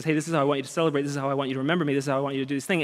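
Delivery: hushed rapid quote of God; softly quoting God. Retold or imitated. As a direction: toss off God's words gently under the breath. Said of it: "He says".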